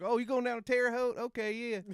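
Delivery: high, loud mock border guard; border officer. Border people answering grandmother, high mocking voice. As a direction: play a guard suddenly catching on. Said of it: "And they're like".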